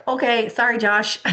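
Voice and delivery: high, loudish self-correction; mock apology. Mom catching herself judging her son, mock-contrite apology.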